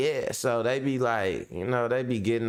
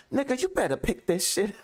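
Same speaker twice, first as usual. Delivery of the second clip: high, scolding mom voice; mom cussing; 12 semitones higher than usual. Retold or imitated. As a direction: order him to clean up in a high mother's voice.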